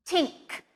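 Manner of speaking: "Think" said as "tink". Shown as a demonstration. Teacher shows learners a mistake, "Think" with a T sound. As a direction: swap the TH in "Think" for a T. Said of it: "just a T sound".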